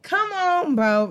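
high-pitched exasperated plea; worked up. Real, not acted. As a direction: plead in disbelief, voice jumping up. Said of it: "I keep getting excited".

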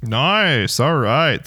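ordinary talk

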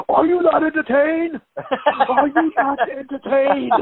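high, slow, grand gladiator-style boom; Morgan Freeman voice. Pretend. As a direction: declaim it like a movie hero. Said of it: "in my Morgan Freeman voice".

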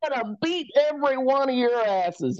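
hammed-up raging old man; irate old man threatening. Driver threatens them all.

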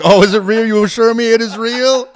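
high, loud, sarcastic echo; sarcastic mocking. Mocking the cop's assurance, loud sarcasm. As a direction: throw his words back mockingly. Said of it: "I'm like".

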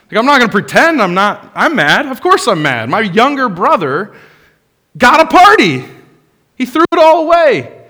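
loud, high indignant rant that climbs as it goes; heated rant. Acted. Older brother complaining about the party, loud and indignant. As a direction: vent like the wronged older brother. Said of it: "I can get pretty heated".

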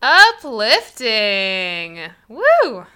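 very slow, drawn-out mock cheer; sarcastic. Real, not acted. Reacting to the massacre ending, dry mock enthusiasm. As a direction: sell the grim ending as a delight.

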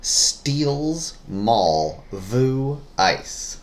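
very slow "Steals... mall... vu... ice", each word apart; word-by-word clue reading. Shown as a demonstration. Reading a puzzle clue to a guessing partner, very slowly word by word. Reads scrambled phrase word by word.

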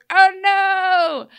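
mock alarm. Mock alarm at Storybrooke plot.

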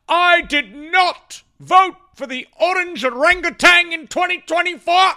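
high, loud, slow mocking voice of a Republican voter. Host mocks Republican voters swearing off Trump, in a high, loud, slow mocking voice. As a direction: lay on the sneering denial big and loud. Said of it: "they'll swear up and down till they die".

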